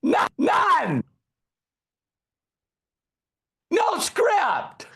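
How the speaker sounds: booming, high-pitched announcement to the audience; excited shout. Real, not acted. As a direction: yell it out like a reveal to the crowd. Punchline about getting no script.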